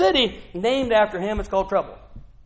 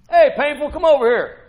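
mock parent calling; 7 semitones higher, 8 dB louder than usual. Parents calling son by name.

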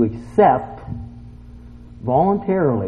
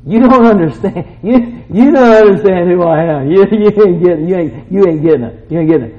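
loud, higher, stammering protest; 6 semitones higher, 8 dB louder than usual. Acted. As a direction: argue back insistently, tripping over words. Acts out protesting to God.

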